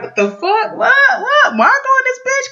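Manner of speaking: high-pitched mock double take; shocked disbelief. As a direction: act stunned, voice jumping way up.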